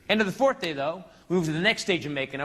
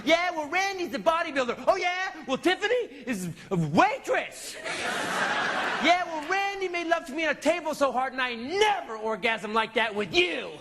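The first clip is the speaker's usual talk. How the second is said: put-on high, childish one-upmanship; mock petty shouting match; 10 semitones higher than usual. Husband and wife one-upping each other in a high childish shouting match. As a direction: top each other like kids.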